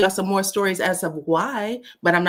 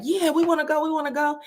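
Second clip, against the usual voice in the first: put-on eager little kid; 8 semitones higher, faster than usual. Little kids answering their mom.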